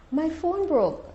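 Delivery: high, crying pitch, imitating; tearful, imitated. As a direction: mimic her tearful wail. Client retold crying on phone.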